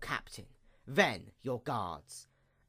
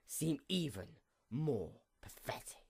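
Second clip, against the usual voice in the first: scornful whisper; 11 dB quieter than usual. Acted. Taunting her beaten sister in a scornful whisper. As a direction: hiss it out soft and scornful.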